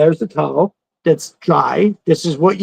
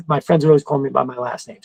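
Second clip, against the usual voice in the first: normal talking voice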